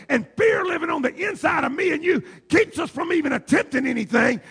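loud, high preaching cry. Acted. Preacher shouting the sermon to the church. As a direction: preach it out to the congregation.

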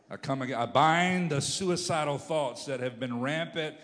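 loud, fervent prayer against a threat; booming, urgent intercession; full-force preaching. Real, not acted.

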